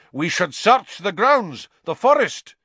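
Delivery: high, loud angry snarl. A narrator's reading. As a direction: snarl out an order to search. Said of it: "the executioner was snarling".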